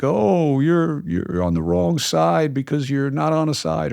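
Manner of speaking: lightly mock a scolding objection; put-on reproachful "oh"; mock critics. Mocking what critics say of him.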